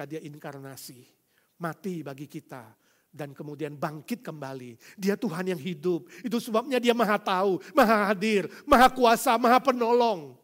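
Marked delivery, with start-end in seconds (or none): 5.0-10.3 louder and higher with each phrase; building preaching. Acted. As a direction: stack each claim higher. Building list of attributes.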